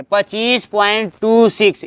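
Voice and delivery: "टू six" read slowly as two separate digits; slow, separated digits on "टू six". Shown as a demonstration. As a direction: space out "टू", then "six", teacher demonstrating. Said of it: "एक एक digit पढ़ते हैं".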